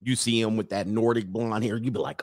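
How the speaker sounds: ordinary delivery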